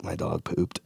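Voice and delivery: hushed, almost whispered; small embarrassed undertone; sheepish confession. Retold or imitated. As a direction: confess it very softly. Sheepishly confessing to flight attendant.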